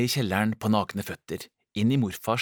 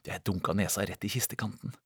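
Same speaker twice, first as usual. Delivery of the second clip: usual speaking voice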